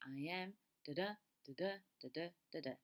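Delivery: "I am, da da" stressed on "am" and the second "da"; iambic rhythm. Shown as a demonstration. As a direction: tap out "da da" as short pairs, second "da" stronger. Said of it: "the emphasis is on the second syllable".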